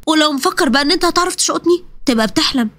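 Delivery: ordinary talk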